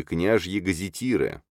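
ordinary delivery, nothing marked

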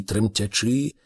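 ordinary delivery, nothing marked

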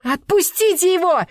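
very high, loud shriek; hysterical scream. A narrator's reading. Girlfriend to the man choking her boyfriend, hysterical scream. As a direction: scream for his release. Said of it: "истерически крикнула Анетта".

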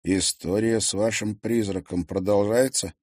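plain reading voice

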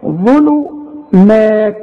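"ظلمات" very slow, syllable by syllable, light مات; correct light مات. Shown as a demonstration. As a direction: spell out "ظلمات" slowly with a thin final vowel. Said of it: "ما تعرفش تقول مات". ("ظلمات" ببطء و"مات" مرققة)

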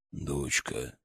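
low, soft fatherly call; father's voice. Acted. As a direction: call gently to a waking daughter. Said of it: "на голос".

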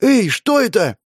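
high-pitched startled call; woman calling out, voice leaping high; alarmed cry. A narrator's reading. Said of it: "крикнула Кейтлин".

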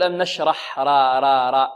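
نشرح and را with a thin front aa; thin r in نشرح, as a mistake; wrongly light raa. Shown as a demonstration. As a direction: demonstrate the error: flatten را to a light 'raa'. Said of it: "ولا نقول".

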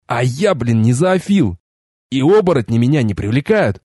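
plain reading voice

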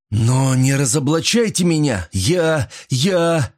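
high, halting plea; desperate plea. A narrator's reading. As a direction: beg in rising panic, then falter. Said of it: "с выражением отчаяния".

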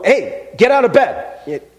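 scolding parent. Retold or imitated. Adult scolding a kid to get out of bed, mock angry. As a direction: bark it like a parent waking a lazy kid. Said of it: "like an adult smacking a kid".

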